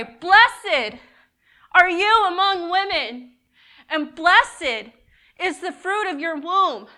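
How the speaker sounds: loud, high, exultant proclamation; loud cry. Acted. Elizabeth exclaiming a blessing to Mary, in a loud cry. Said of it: "She exclaimed with a loud cry".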